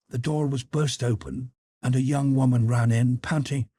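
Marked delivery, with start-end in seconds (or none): none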